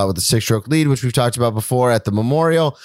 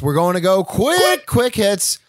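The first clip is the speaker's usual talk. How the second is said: excited buildup; 7 semitones higher, slower than usual. Acted. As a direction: pitch climbing, rushing to the segment. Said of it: "All right, quick hits".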